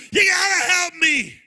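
loud, high cry for help; desperate plea. Acted. Widow begging the prophet for help, loud and high. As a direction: beg for help at full voice. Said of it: "begging for somebody".

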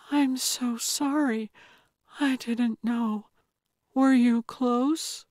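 high, small apologetic voice; high hushed apology to the aunt; young Anna, stricken. A narrator's reading. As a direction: speak up in shock and sympathy. Anna's voice, shocked apology.

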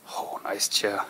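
ordinary delivery

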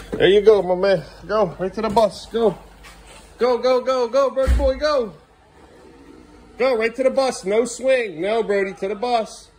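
high, excited encouragement; cheering on. Real, not acted. Dad sends son to the bus, cheering high.

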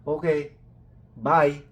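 loud, high "Okay, bye" in a put-on foreign voice; loud mimicked phone sign-off; mock foreigner goodbye. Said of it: "sabi niya".

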